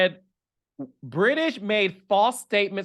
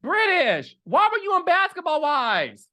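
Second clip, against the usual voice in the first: exasperated; 10 semitones higher, 3 dB louder than usual. Real, not acted. Scolding the celebrity directly.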